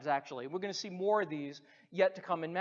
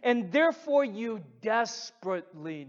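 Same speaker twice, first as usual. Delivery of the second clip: impassioned appeal; 4 semitones higher, 4 dB louder than usual. Acted. Preacher appealing to congregation directly.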